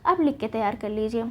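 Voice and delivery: ordinary talk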